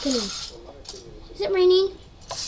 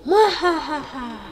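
evil cackle. Pretend. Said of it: "I'm a witch".

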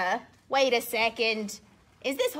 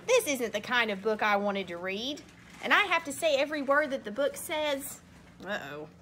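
ordinary delivery

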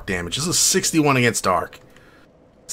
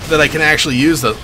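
usual speaking voice

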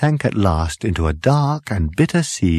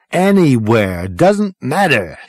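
somewhat raised, hurried-sounding dismissal; impatient; 5 semitones higher, 4 dB louder, slower than usual. A narrator's reading. Zaphod tells the computer to go anywhere, impatient. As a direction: brush the question off urgently.

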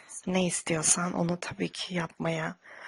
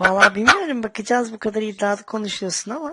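loud teasing; 3 semitones higher, 10 dB louder than usual. Acted. Teasing challenge, much louder.

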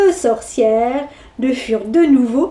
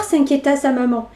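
ordinary delivery, nothing marked

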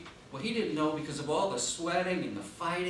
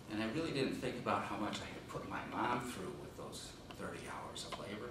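ordinary delivery

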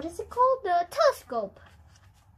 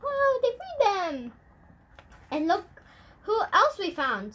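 excited announcement; 7 semitones higher, 4 dB louder than usual. Acted. Humpty Dumpty announces.

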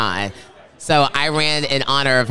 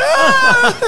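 very high, loud mock wail; exaggerated squeal of someone bursting into sobs; mock sobbing wail; 8 dB louder than usual. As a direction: burst into a hammed-up crying wail. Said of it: "And I was just sobbing".

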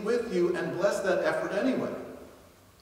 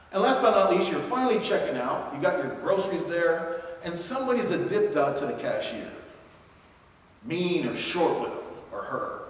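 normal talking voice

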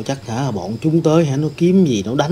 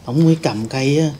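normal talking voice

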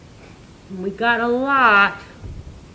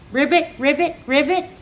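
playful ribbit, pitched higher; frog croak imitation; 5 semitones higher, faster than usual. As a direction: croak like a little frog for the kids. Imitating frogs for children.

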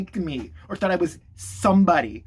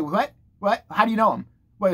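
ordinary talk

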